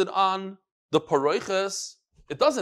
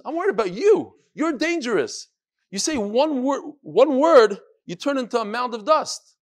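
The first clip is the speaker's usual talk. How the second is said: higher and louder, pleading; a worried father raising his voice; alarmed warning; 7 semitones higher, 6 dB louder than usual. Acted. Father warns against danger.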